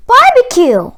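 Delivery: excited shout of recognition; excited discovery. Acted. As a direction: shout it like the smell just hit. Jack smells barbecue.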